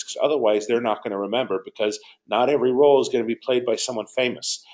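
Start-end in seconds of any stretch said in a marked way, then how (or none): none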